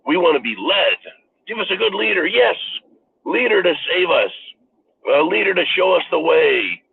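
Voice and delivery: high, whiny plea for a leader; hammed-up needy followers; mock pleading crowd. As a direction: beg like sheep wanting a shepherd. Mocking people begging for leaders.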